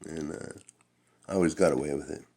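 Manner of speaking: ordinary talk, nothing marked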